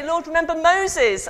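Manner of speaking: high, loud cry to the whole temple; loud priest call. Acted. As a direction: belt it out over thousands of worshippers. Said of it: "then the priest would say".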